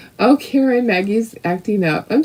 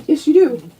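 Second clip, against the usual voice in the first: high teasing reply; playful to dog; 7 semitones higher than usual. Real, not acted. To her dog, high playful insistence. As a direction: tease the pup back.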